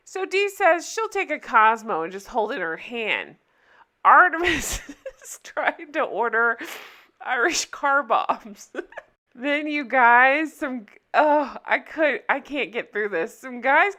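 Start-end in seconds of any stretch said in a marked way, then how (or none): 4.0-8.8 real giggles breaking up the words; laughing while talking. Real, not acted. Recapping a friend ordering drinks, laughing while talking. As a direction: struggle to get it out through laughter.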